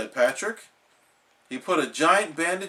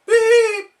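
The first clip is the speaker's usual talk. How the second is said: high, loud mock beep tone; fake beep; 10 dB louder than usual. A narrator's reading. Patrick faking an answering machine beep, high.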